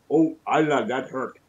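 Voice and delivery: hint of a British accent on a pained cry; lightly voiced British exclamation. Imagining hitting his thumb, a mild British accent cry. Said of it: "imagine hitting yourself and going".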